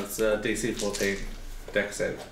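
normal talking voice

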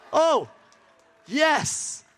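high delighted exclamation; acted cheer. Preacher acting out watching a paper plane fly, high cheer. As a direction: cheer as if the plane finally flies.

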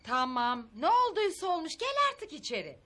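high-pitched, exasperated coaxing; fed-up wife calling him in; impatient urging. Acted. Wife urging drunk husband indoors.

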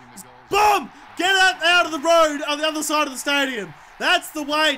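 exultant sports-gamer yell; triumphant shout. Real, not acted. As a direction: cheer your monster kick out of the stadium. Celebrates a big kick.

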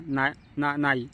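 halting no with broken false starts; a mild stammered denial; stammering. A narrator's reading. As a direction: trip over the first no, then get it out. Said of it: "अडखडत बोलला".

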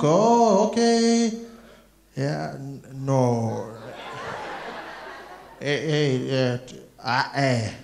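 put-on slow, heavy-accented doorman inspecting ID; hammed-up disbelief, building to a drawn-out apology; exactly who she expects. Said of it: "be exactly who she thinks I am".

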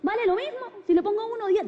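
high, eager customer voice; hammed-up father haggling over names; dad asking the clerk.

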